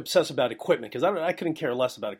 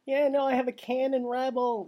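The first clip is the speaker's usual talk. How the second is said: higher put-on voice, mocking; mocking amateur; 11 semitones higher than usual. Mocking the amateur who boasts of her camera, high voice. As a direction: parody a proud newbie. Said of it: "she's like".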